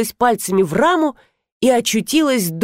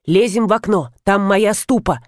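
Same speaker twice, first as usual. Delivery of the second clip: ordinary delivery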